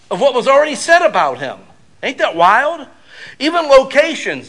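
loud, high preaching; preaching at full force. Real, not acted. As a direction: drive it home to the congregation. Preaching climax.

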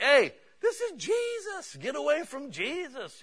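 very high, loud, drawn-out caricature; mock indignant scolding. Disciples keeping children away from Jesus, mock indignant scolding. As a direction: shoo them off in a high, exaggerated cry.

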